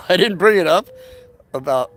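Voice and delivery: said through a laugh. Real, not acted. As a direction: laugh while you say it. Laughing at own aside.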